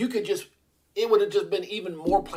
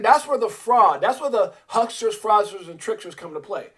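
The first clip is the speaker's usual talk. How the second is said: ordinary talk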